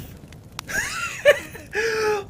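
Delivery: high, loud maniac laughter; shrieking mad laugh. Acted. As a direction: laugh like a madman after losing a hand. Maimed man laughs.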